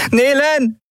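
high, urgent shout of a name; calling out. A narrator's reading. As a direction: call for your friend across the noise. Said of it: "rief Endriel". (laut und hoch gerufen)

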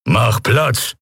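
mildly grumbled order; grumbling. A narrator's reading. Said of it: "brummte er". (leicht brummig)

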